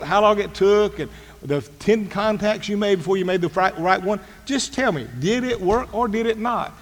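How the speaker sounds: exasperated demand for just the result; impatient boss. Retold or imitated. Acted impatient boss cutting staff short.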